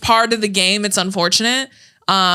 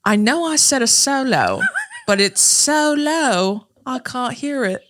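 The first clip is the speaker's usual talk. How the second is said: slow, higher mock-British uncle voice; imitated British accent; 5 semitones higher, slower than usual. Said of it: "Why was he British".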